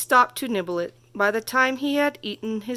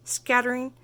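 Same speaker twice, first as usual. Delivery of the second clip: ordinary delivery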